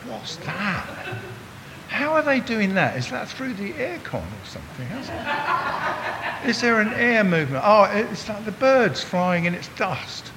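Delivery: a cynical skeptic, pitch well up, laughing; mock the sceptic questioning everything; imitating his cynical self. He mimics his cynical younger self questioning the glory cloud. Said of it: "And I go".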